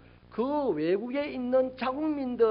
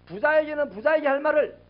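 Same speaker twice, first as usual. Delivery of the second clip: ordinary talk, nothing marked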